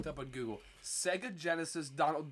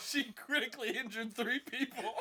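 laughing; 6 semitones higher than usual. Real, not acted. Laughing at own school-shooting joke.

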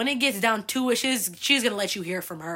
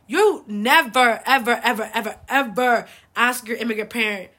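every ever punched hard and louder; emphatic ever; 6 dB louder than usual. Real, not acted. Telling immigrant kids, stressing each ever loudly. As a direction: hammer home each ever as a warning.

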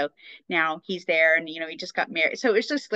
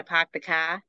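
Boston accent with dropped r's; Boston accent attempt. Shown as a demonstration. Showing friend the accent, imitated Boston accent. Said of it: "how to do it with the accent".

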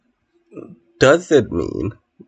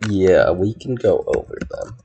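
ordinary talk, nothing marked

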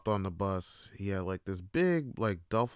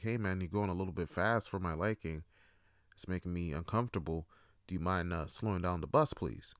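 soft, low, courteous asking; polite request; 4 semitones lower, 6 dB quieter than usual. Pretend. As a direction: ask gently and hesitantly, keeping it low. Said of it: "you politely ask".

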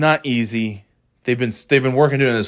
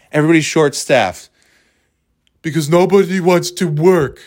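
higher, put-on whine of a complainer; mocking parroted complaint; 4 semitones higher, 4 dB louder than usual. Quoting people who blame short staffing, mocking complaint.

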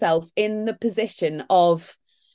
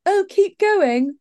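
mock patronising; 12 semitones higher, 5 dB louder, slower than usual.

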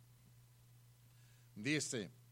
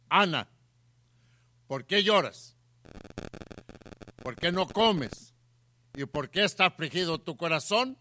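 loud, projected verse with raised questions; forceful scripture reading; 5 semitones higher, 10 dB louder than usual. A narrator's reading. Preacher reading Elcana's words to Ana, loud and forceful. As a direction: declaim the husband's questions to the room.

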